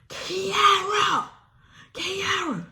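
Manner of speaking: high, drawn-out call of a name from downstairs; mom calling out. Retold or imitated. Mom calling her daughter up the stairs, high drawn-out call. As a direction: sing out the name like a mom summoning her kid.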